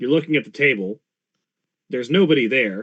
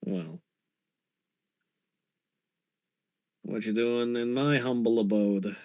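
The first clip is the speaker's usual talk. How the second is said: soft-spoken roguish ghost, pitched a little down; Hildat; 4 semitones lower, 7 dB quieter than usual. Acted. Ghost uncle questions his visitor at home, soft and low. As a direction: keep it quiet, as the shady dead uncle.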